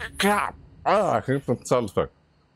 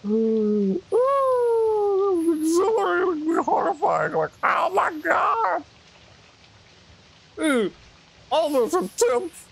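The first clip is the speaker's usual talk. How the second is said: breathless excitement; slower than usual. Real, not acted. Exhilarated after escape.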